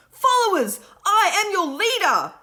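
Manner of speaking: high, loud leader's proclamation; proclaiming shout. A narrator's reading. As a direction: claim command of the room. Will proclaiming himself leader.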